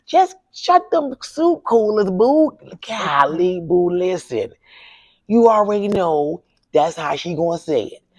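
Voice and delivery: playfully channel her husband scolding her like he used to; Larry impression. Widow doing her late husband's voice for the host, high and slowed. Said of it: "I know how to talk like my husband".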